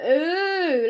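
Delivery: long, mock-amazed crowd "oooh"; awed "ooh". A narrator's reading. Pupils watch the glowing letters appear, long awed "ooh". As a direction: coo it like watching a magic trick. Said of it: "like it's a magic trick".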